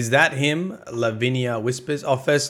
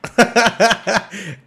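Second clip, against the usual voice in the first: delighted laugh; 10 semitones higher, 6 dB louder than usual. Real, not acted. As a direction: burst of surprised laughter. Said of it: "Oh, I said it".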